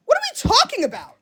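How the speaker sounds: mocking high voice. Mocking echo of chat.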